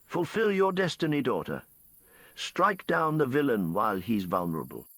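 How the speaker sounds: high ringing call that settles down; a hint of a villainous queen calling out. A narrator's reading. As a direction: command your daughter from below. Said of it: "Victoria calls out".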